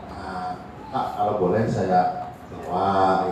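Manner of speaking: soft, low, polite request; hushed, deferential asking; softly spoken. Shown as a demonstration. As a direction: ask gently and tentatively, voice dropped. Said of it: "ngomongnya pelan-pelan ya".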